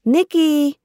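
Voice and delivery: high, loud call of a little sister; shouted name. Acted. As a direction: yell her name through the door. Little sister yells through bathroom door.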